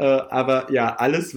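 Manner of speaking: ordinary talk, nothing marked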